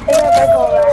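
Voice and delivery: squeaky excited gamer yell; excited high shout. Real, not acted. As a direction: shout it out in a sudden burst of hype. Hyped moment in the match.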